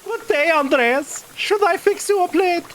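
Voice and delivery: put-on high-pitched character voice with an accent; Marie. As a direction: pitch it well up as the hostess. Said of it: "Ooh, Marie".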